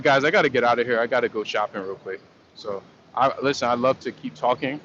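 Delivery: usual speaking voice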